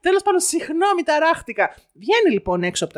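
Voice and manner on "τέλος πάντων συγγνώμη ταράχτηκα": flustered apology. Retold or imitated. Mother apologises after her tirade.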